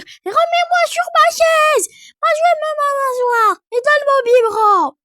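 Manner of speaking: put-on high, loud little child fed up with mom; cheeky toddler voice. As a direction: play the sulky small kid talking back. Toddler bossing mom around.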